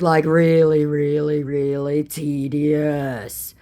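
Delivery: drawn-out, weary repetition; exasperated. Retold or imitated. Telling the scammer he bores her, exasperated. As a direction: stretch the last word right out.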